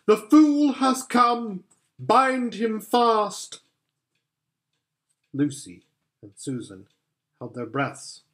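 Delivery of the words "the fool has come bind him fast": high, slow, loud witch command; witch's triumphant order. Acted. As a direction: savour each word as the gloating witch. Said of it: "she cried".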